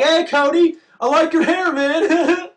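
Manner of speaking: high, loud teasing schoolkid voice; mocking classmates. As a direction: jeer at him in a high sing-song kid voice. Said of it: "whole fucking eighth grade class is like".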